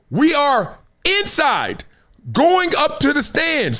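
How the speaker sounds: high, slow, stretched out with excitement; excited retelling. Retold or imitated. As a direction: relive the thrill of walking in. Excited arrival at game.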